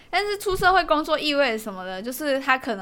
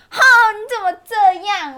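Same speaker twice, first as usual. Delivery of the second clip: high, louder pouty whine; pouting cute voice; 9 semitones higher, 8 dB louder than usual. Retold or imitated. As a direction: act cute with lips pushed out. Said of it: "嘟着嘴说".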